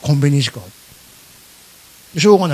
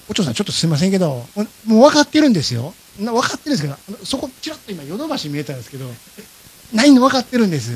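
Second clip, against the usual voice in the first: quick, higher, apologetic wheedling; insistent pleading; 4 semitones higher, faster than usual. Retold or imitated. Asking to peek in for a Switch he knows is sold out, pleading. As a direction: press the request while conceding it is hopeless. Pleading with partner to check shop.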